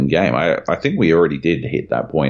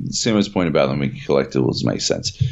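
ordinary talk, nothing marked

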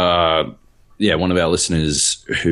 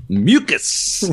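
high-pitched proud announcement, imitated; mock triumphant; 8 semitones higher than usual. Chemist finding her one English word, mock triumphant.

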